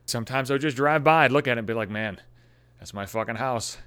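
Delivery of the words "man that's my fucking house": weepy mutter. Retold or imitated. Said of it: "Just weep in the streets".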